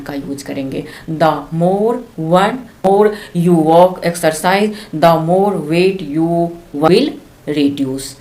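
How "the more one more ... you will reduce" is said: every word stretched out slowly; slow example read. Shown as a demonstration. Teacher reading the exercise example to her class, very slowly. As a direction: spell the sentence out slowly for learners. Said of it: "teacher reads example sentence".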